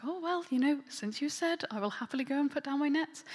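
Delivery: happily oblige, voice lifted and loud; willing deference. Acted. A fisherman agreeing to his master's request, willing deference. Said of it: "so it could be translated, he's like".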